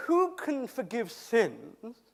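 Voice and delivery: slow, weighted rebuke; a hint of a pompous teacher; indignant question. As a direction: draw it out like a scandalised scholar. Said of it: "this chap stands up and he says".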